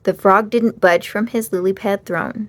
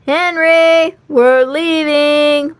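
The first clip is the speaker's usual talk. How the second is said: high, loud, slow call; 6 semitones higher, 6 dB louder, much slower than usual. A narrator's reading. Mom calls Henry to leave, loud and drawn out. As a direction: call across the creek. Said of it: "Mom called".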